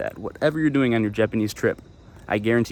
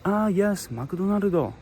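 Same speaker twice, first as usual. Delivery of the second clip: Japanese local recognizing; 7 semitones higher, slower than usual. Retold or imitated. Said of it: "they will be like".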